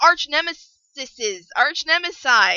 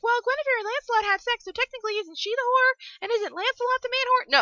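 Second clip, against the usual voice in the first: higher-pitched mock objector; everyone's objection; 9 semitones higher than usual. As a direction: put on a high voice for the nitpickers. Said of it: "everyone's like".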